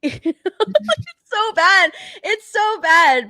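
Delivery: high, slow, laughing it's so bad; can barely get it out for laughing; laughing through words. Real, not acted. Laughing to a friend about how awful the show is.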